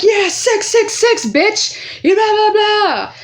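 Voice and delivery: high, jeering sing-song comeback; mocking retort. Narrator firing back at the bully with a high jeering retort. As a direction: fling the taunt back at her.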